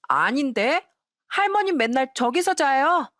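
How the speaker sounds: put-on high, innocent little-kid voice; a small child asking grandpa; grandchild's voice. Grandson asks grandpa about sleeping there, in a high child voice. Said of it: "첫째 손주가".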